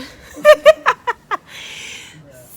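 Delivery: high shrieking laugh; loud laughter. Real, not acted. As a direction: crack up laughing loudly. Laughs hard at her story.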